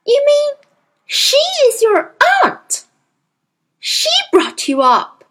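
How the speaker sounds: put-on a girl who can't believe it; astonished child. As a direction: Matilda aghast at the news. Child stunned by news.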